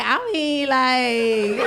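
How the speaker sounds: drawn-out, exaggerated "like"; extra daughter. Daughter answering whether she's hungry, extra and drawn-out. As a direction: mimic an over-the-top daughter. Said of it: "she talks so extra".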